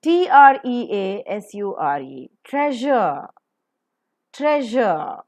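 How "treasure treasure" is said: "treasure" with a puff on t, careful zh; aspirated model pronunciation. Shown as a demonstration. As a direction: say "treasure" higher and separately, falling at the end. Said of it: "Aspirate ter, pronounce je properly".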